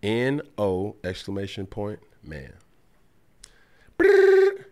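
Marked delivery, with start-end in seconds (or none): 4.0-4.6 high buzzy ring; phone ring. Retold or imitated. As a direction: imitate a phone ringing. Imitating phone ringing.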